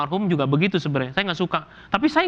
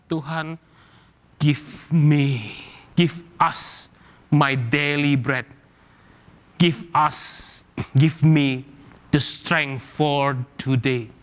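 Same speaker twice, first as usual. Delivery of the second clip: hushed prayer; 4 semitones lower, much slower than usual. Acted. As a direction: pray it slowly and low, almost under the breath. Prayer voiced in English.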